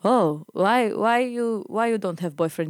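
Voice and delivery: mimicked prying old driver, accented English; creepy old man. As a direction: play a nosy old Lebanese man. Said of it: "this creepy old man".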